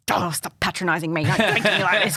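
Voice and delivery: hammed-up objection to being patronised; inner sceptic. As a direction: protest like a fed-up inner sceptic. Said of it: "little skeptic inside my head's like".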